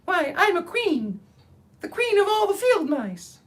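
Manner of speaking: high, tiny royal squeak; a little mouse proudly declaring her rank; proud mouse queen. Acted. Mouse queen voiced in story.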